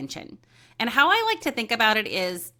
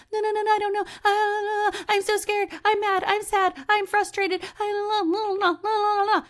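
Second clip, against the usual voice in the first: much higher, rushed babbling mind; frantic inner chatter; 13 semitones higher, 3 dB louder than usual. Pretend. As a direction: babble like a panicked mind that won't stop. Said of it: "constantly in your own mind going".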